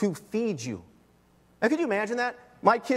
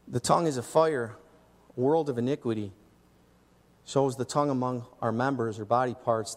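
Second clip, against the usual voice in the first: lower, measured scripture reading; 7 semitones lower than usual. Acted. Pastor reading James to the congregation, low and measured. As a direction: read the verse low and steady.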